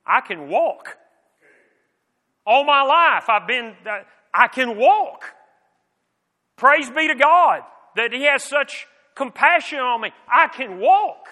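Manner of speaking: voiced healed man, rising with wonder; imitating the healed man's amazed delight; joyful amazement. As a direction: let the joy of walking break through. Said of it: "I can pretty much tell you what I would have thought".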